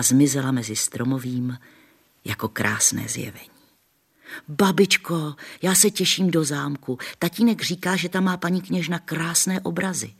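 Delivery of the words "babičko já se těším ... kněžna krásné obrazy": put-on higher girl's voice, excited; little girl Barunka. A narrator's reading. Barunka telling grandma she's excited, girlish. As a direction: sound like a girl looking forward. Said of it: "řekla barunka".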